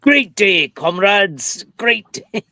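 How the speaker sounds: mild Scottish submarine captain voice; lightly voiced Scottish captain rallying his crew; Scottish captain accent. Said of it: "submarine captain with a Scottish accent".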